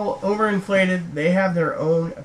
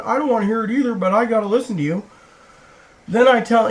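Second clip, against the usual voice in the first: ordinary delivery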